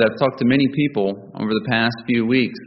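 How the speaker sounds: normal talking voice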